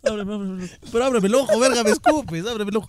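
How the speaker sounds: más agudo y quejoso, con el ojo ardiendo; suplicando con dolor. Real, not acted. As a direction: pide ayuda quejándose del ardor. Le arde el ojo. (quejido agudo porque le arde el ojo)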